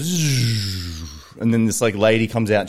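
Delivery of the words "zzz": engine noise. Retold or imitated. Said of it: "the engine goes".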